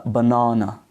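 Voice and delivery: "banana" the British way, buh-NAH-nuh; British "banana", open ah in the middle; British accent. Shown as a demonstration. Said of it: "the British accent pronounce is as".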